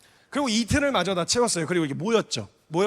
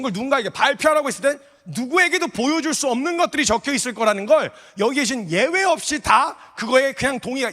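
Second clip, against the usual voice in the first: louder, higher sermon voice pressing the point; fired-up preaching; 3 semitones higher, 3 dB louder than usual. Acted. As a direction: preach it to the whole congregation. Preaching builds to full force.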